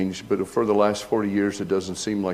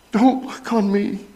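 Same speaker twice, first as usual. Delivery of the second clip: Peter pleading; 12 semitones higher, 4 dB louder than usual. Acted. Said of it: "fell down on his knees".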